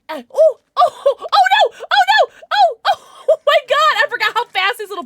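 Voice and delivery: high, loud panicked laughing shrieks; panicked shrieking. Real, not acted. Panicked by mobs.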